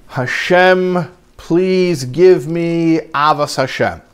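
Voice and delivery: mild mock crying, drawn-out plea to God; retold tearful plea. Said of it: "was crying".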